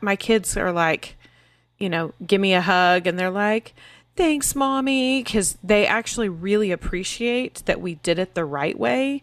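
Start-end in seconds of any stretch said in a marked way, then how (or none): 4.2-5.2 put-on higher, drawn-out kid's thanks; grateful child. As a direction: imitate an appreciative child, slowly. Voices grateful kids thanking her.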